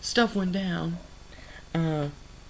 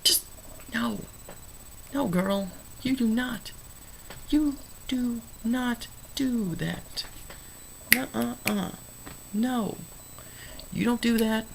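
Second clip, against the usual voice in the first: scolding; 6 semitones higher than usual. Real, not acted. Scolding the movie character.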